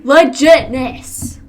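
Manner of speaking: slow loud hyped shout. Pretend. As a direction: stretch it out, pumped up. Hyped drawn-out exclamation.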